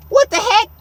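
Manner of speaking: high, loud incredulous outburst; stunned, voice jumping way up; shocked disbelief. Retold or imitated. Kid on the bus hearing slurs behind him, shocked disbelief.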